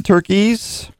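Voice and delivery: normal talking voice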